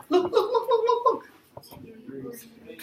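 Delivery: high, slowed, sing-song look look look; playful high look. Acted. Teacher teasing students before the answer, in a high sing-song. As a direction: tease the class with a magical reveal. Teacher dramatizing magic reveal.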